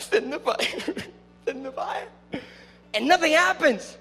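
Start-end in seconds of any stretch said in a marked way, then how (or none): 0.0-2.1 weirdo prophets' plea. Acted. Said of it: "What a weirdo".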